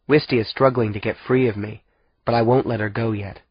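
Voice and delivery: ordinary delivery, nothing marked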